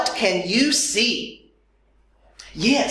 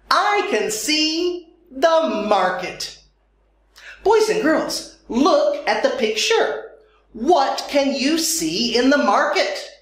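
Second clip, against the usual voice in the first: ordinary talk, nothing marked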